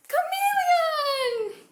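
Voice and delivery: very high, long, loud cheer; blast-off shout. Pretend. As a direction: holler it to the skies as you jump. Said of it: "jump in the air and say chameleon and let everyone know".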